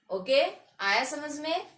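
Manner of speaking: ordinary talk, nothing marked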